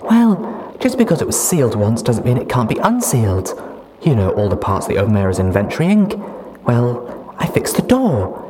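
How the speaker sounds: put-on high, loud, giddy young mare. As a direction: chatter happily about the future. Duct Tape proud.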